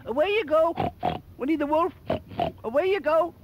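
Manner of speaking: very high, loud piglet chorus; little pigs sending the wolf away, high and loud; squeaky piggies. Acted. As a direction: chant them away in tiny pig voices. Pigs answer in unison.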